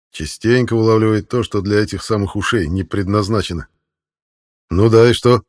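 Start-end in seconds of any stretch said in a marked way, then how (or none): 4.7-5.4 irritated. A narrator's reading. Annoyed sergeant answers.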